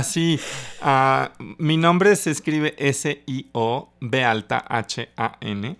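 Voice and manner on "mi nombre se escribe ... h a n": slow, letter by letter spelling. Shown as a demonstration. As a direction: spell each letter out slowly. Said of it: "Ahora sí cabría deletrear tu nombre".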